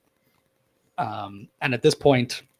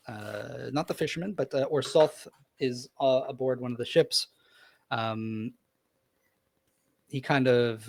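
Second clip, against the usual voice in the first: usual speaking voice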